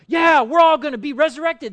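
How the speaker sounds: high, loud burst of agreement; excited crowd shout; crowd agreeing loudly. Acted. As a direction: shout it like a worked-up crowd member. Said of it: "people in the crowd would be like".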